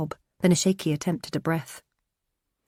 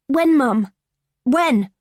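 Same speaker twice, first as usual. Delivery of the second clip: high, loud insistent question; desperate pleading; 9 semitones higher, 8 dB louder, much slower than usual. Acted. Daughter pressing her mum for an answer, desperate. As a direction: demand an answer through tears.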